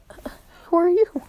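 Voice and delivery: high-pitched, hesitant little question; high voice of a wary kid; timid questioning. Acted. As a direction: act out a startled newcomer, voice lifted high. Retold kid meets imposing strangers.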